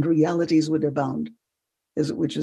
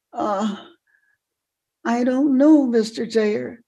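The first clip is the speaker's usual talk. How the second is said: hesitant, higher reply with pauses; puzzled young self; 5 semitones higher than usual. Retold or imitated. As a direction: admit you have no idea. Retold puzzled teenage self.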